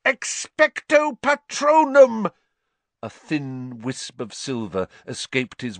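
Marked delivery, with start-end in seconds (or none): 0.0-2.3 very high, loud, slow yell; desperate yell. A narrator's reading. Harry against the closing dementors, loud yell. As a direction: drag the spell out at full force.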